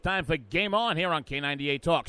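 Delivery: big show-opening announcer voice, stretching the final titles; radio announcer intro. Acted.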